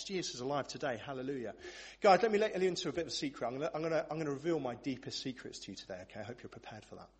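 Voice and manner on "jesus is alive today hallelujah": soft, half-hearted praise; mock a congregation that can't be bothered; low-key, unenthusiastic hallelujah; underwhelmed. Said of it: "that underwhelming response".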